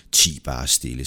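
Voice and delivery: low-pitched hushing; calm, low put-down; curt rebuke. Acted. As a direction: silence him in a low voice. Peter rebukes his nagging companion.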